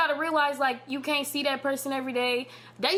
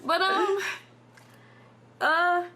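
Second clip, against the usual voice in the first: shaky sigh; 7 semitones higher, 4 dB louder, much slower than usual. Real, not acted. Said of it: "I'm trying not to cry".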